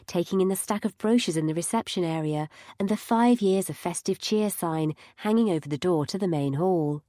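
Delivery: ordinary delivery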